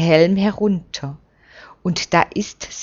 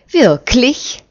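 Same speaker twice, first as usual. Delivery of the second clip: sharp, higher and louder challenge; hard retort; 6 semitones higher, 5 dB louder than usual. A narrator's reading. Intruder challenges the priest, hard sharp retort. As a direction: snap the question back hard. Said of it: "tönt es hart".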